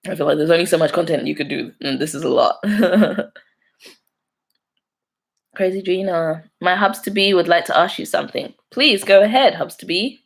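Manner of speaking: normal talking voice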